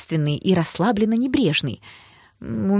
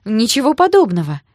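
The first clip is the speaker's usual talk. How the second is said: loud, higher-pitched denial; objecting; 5 semitones higher, 6 dB louder than usual. A narrator's reading. Girl denies to sister she loves him, protesting. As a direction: protest at once. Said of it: "тут же стала возражать я".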